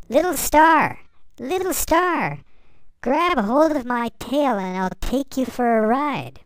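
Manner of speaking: high, loud friendly call across space. A narrator's reading. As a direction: call out cheerfully to a lost little star. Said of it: "a friendly voice calling out".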